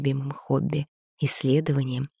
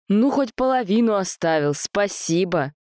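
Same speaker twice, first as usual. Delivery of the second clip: ironic shout; 4 semitones higher than usual. Acted. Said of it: "иронично крикнул он кустам".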